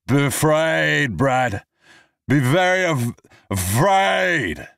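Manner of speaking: high, loud, slurred threat; drunken bravado. Acted. Drunk man threatens Brad, loud high slurred bravado. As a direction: ham up the drunken menace.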